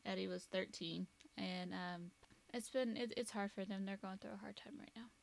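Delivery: quiet, softened grief, dropping to near whisper; real, subdued heartache; holding back tears. Real, not acted. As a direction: speak softly through real sadness, trying not to cry. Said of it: "I'm trying not to cry again".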